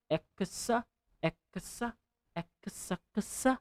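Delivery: ekasa in separated syllables, rising; syllable drill. Shown as a demonstration. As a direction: break the cluster into syllables. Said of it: "Like you say".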